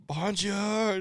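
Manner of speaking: high, drawn-out bonjour in pain; mock pained bonjour. Joking about a stabbed tourist, high pained wail. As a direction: cry bonjour like you're being stabbed. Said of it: "just in pain".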